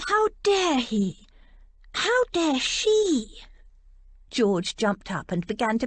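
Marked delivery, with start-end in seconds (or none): 0.1-3.4 high, very slow, indignant; drawn-out outrage at both of them; outraged. A narrator's reading. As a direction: stress on How, furious disbelief. George furious at both.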